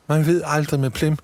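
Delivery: put-on high, bright-eyed elf girl voice; a small elf thrilled by chocolate; high childlike elf delight; Polly the elf. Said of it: "siger Polly".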